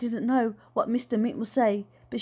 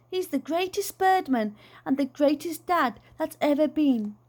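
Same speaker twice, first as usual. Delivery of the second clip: a daughter bursting with pride; proud yelling; 7 semitones higher than usual. A narrator's reading. Lizzie yelling to the crowd about her dad, proud and high. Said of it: "yelling out to anyone who'd listen".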